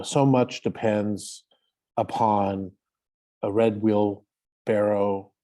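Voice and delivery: each word laid out slowly with long pauses; slow poem recital. Shown as a demonstration. Reciting William Carlos Williams to the group, slow and deliberate. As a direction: read it like a poem, letting each line hang.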